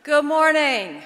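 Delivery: big, slow announcer greeting; loud greeting. Real, not acted. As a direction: call it out to the whole hall. Opening a ceremony to crowd.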